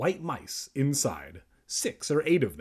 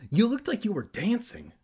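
much higher-pitched teasing remark; exclaiming; 9 semitones higher than usual. A narrator's reading. Andy reacts to Evan's electrified wriggling, high exclaiming. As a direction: pitch it way up, lively. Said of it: "Andy claimed".

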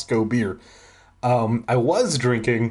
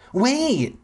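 mock yell; 12 semitones higher than usual. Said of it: "Simon yelled".

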